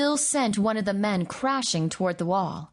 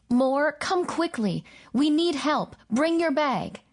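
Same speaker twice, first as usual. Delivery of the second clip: urgent plea; 7 semitones higher than usual. Acted. Urgent phone call for help.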